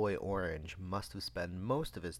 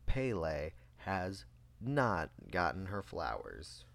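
normal talking voice

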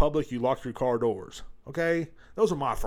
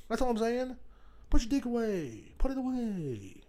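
comic pleading; 9 semitones higher, 4 dB quieter than usual. Pretend. Host pleading with listeners to behave, high-pitched. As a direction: stretch each plea high, then let it slide down.

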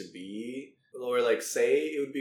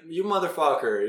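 mock outrage; 5 semitones higher, 6 dB louder than usual. Reacting to friend's prank.

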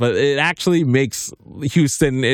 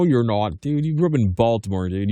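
ordinary talk

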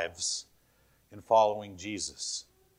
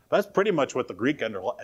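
ordinary talk

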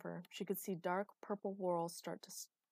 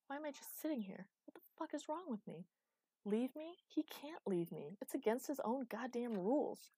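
higher-pitched indignant disbelief; dawning anger; 6 semitones higher than usual. A narrator's reading. She realises he cannot leave her, dawning anger. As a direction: question it with growing indignation. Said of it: "she started to get angry".

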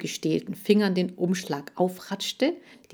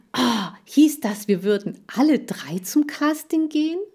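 gasped, high, rising disbelief; astonished; 7 semitones higher, 4 dB louder than usual. A narrator's reading. Hamster learns all three are going, astonished. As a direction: start with a gasp, climb into the question.

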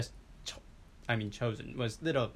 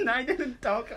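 protesting through laughter, higher pitched; laughing; 11 semitones higher, 3 dB louder, slower than usual. Real, not acted. Denying an insult to a mock-angry friend, laughing. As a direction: laugh while you defend yourself.

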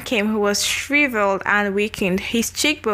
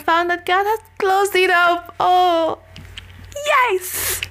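excited; 8 semitones higher, slower than usual. Real, not acted. Joyful climax of healing story.

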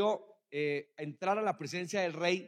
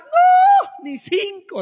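loud, very high no; preacher's scornful cry; sudden high shout; 13 dB louder, much slower than usual. Real, not acted. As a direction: blast the no out in disbelief. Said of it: "preacher rejecting tiny goal".

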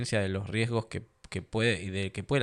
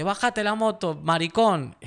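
much higher, louder mock shout of abuse; insulting driver; 10 semitones higher, 4 dB louder, slower than usual. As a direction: taunt the biker like a raging driver. Driver insulting him, hitting car. (grito insultante imitado, mucho más agudo)

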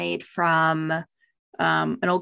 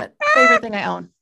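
laughing exclaim; 3 semitones higher, 7 dB louder, much faster than usual. Real, not acted. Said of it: "You are hilarious".

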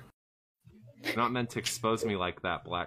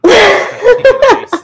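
laughing; 16 dB louder than usual. Real, not acted. Laughs mid-sentence very loud.

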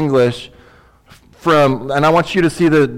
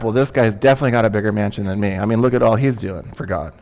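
envious comparing; 5 semitones lower, 3 dB quieter, much faster than usual. Retold or imitated. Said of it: "I'm already sizing it up".

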